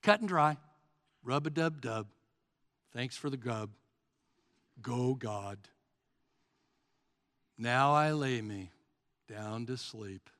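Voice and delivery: dull, rote mumbled grace; Eeyore. Acted. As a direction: recite it by rote, soft and lifeless. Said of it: "we sound like Eeyore of Winnie the Pooh".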